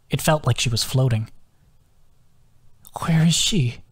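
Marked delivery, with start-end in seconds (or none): 3.0-3.8 raspy, dry-throated whisper; hoarse whisper. Acted. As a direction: whisper it through a sore throat. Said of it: "Her voice was like sandpaper".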